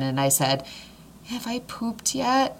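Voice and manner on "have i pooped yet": high, slow, small voice; timid retold question. Labouring wife asks her husband, high and timid. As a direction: ask it slowly, high up.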